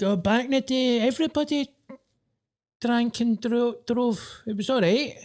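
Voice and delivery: high-pitched mock reminiscing voice; nostalgic excuser. Friend defending drink-driving back in the day, high mock-nostalgic voice. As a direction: put on a high voice waving it all away.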